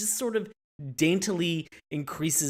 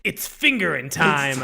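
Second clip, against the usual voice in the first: slow mock catchphrase; 8 dB louder, slower than usual. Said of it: "he was like".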